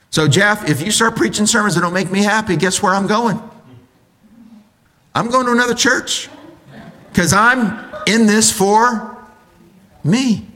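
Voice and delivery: high-pitched mock complaint to the pastor; high, entitled churchgoer voice; self-centered churchgoer. Acting a self-centered church member.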